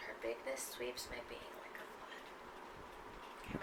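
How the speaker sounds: slow near-whisper of the line; soft repeat. Acted. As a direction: let "bigness" rise softly, barely voiced. Repeats line much softer.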